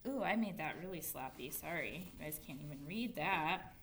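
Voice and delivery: soft, low self-criticism; quiet aside. Real, not acted. Teacher apologises to pupils for sloppy writing, quiet and low. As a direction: drop the voice for an apology.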